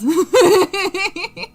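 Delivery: a bright, high burst of laughter; loud high laugh. Real, not acted. Streamer laughs at the chat's tea joke, high and loud.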